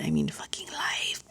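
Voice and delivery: breathy whispered curse under the breath; whispered aside. Real, not acted. Whispering an aside to viewers about life. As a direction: drop to a whisper for the punchline.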